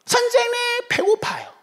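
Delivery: high-pitched imitation of hungry kids; whining children. Hungry children pestering their teacher, high imitated plea. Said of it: "이 아이들의 입술에는 방언이 터집니다".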